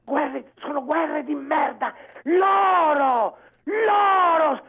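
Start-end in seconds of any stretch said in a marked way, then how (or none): 2.2-4.6 long, drawn-out yell on each word; real furious holler; enraged shout. Real, not acted. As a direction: cry it out in raw indignation. Caller raging about the government.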